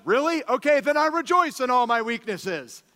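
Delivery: Paul brightening up with sudden joy; excited Paul. Retold or imitated. Paul's voiced reply.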